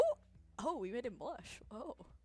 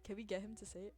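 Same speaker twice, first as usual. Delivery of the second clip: ordinary talk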